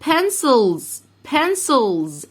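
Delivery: بنسلز with a clear z ending, said loud and high; model plural pronunciation. Shown as a demonstration. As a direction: stress the z in بنسلز. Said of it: "teacher models plural". (نطق بنسلز بوضوح)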